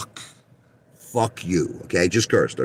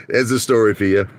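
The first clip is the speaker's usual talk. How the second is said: ordinary talk, nothing marked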